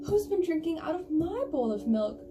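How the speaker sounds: high, loud, indignant bear voice; medium bear. Acted. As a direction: pitch it up for the offended middle bear. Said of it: "and they say".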